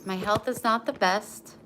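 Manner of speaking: normal talking voice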